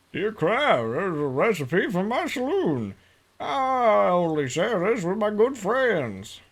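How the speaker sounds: reading a letter in a put-on southern drawl; swooping southern saloon-keeper voice; southern character.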